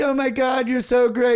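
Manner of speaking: high, loud mock squeal; squealing gushing mom. Friend mimicking the moms squealing in his ear, loud and high. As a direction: squeal it like a starstruck fan.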